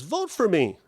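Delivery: high, bright mock girl pitching her vote; a young canvasser chirping her pitch, lightly imitated; chirpy campaigner. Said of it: "She says".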